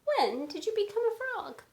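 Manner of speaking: Gerald amazed. Acted. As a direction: ask in wide-eyed wonder. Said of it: "When I say the Gerald part".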